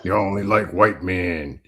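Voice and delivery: low, soft, mocking whine; a hint of a scornful complainer; mock complaining voice. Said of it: "What you gonna say then".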